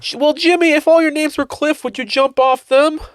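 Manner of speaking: very high goofy dad voice; a dim dad giving bad advice; Jimmy's dad voice. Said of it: "the other one that he said".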